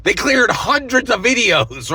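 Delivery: high, slow, stretched-out outrage; indignant emphasis. Real, not acted. Vlogger stressing to viewers how many were wiped, loud and high.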